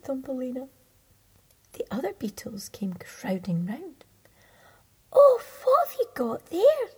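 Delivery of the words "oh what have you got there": very high, loud nosy beetle; shrill curious crowd; beetles' voice. Acted. Beetles crowding round the captive, very high and loud.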